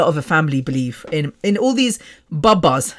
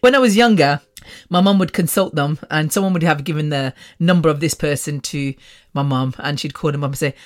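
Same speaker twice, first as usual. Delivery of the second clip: usual speaking voice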